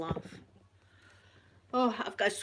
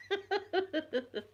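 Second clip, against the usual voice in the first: high laugh; 11 semitones higher than usual. Real, not acted. Laughing about phoning mum.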